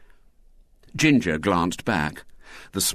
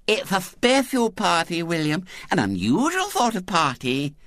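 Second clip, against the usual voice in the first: put-on high lisping child, insistent; little-girl voice; 11 semitones higher than usual. A narrator's reading. Girl tempting William with her party, high lisping voice. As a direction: coax like a small girl with a lisp.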